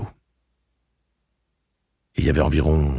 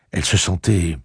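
plain reading voice

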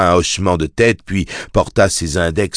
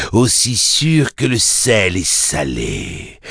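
infernal voice; 2 semitones higher, slower than usual. Acted. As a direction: savour each word like a cruel fiend. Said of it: "lança la voix infernale".

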